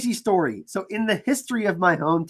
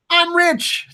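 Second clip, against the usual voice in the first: mock boast; 11 semitones higher than usual. Jokes about a tiny donation.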